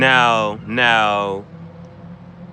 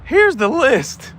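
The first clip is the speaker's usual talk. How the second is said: high, slow hammed-up reveal; mock reveal; 11 semitones higher, 6 dB louder, much slower than usual. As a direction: present the list high and drawn out, louder. Voicing the shirt's back.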